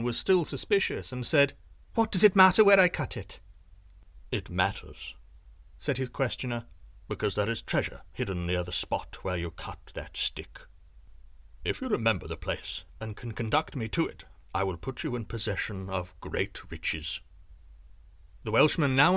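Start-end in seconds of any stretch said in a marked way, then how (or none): 1.9-3.4 high, loud suspicious snap; suspicious retort. A narrator's reading. 4.3-5.1 low looming threat. A narrator's reading. Sorcerer voiced in story. 7.1-17.2 low looming threat. A narrator's reading. Sorcerer voice held.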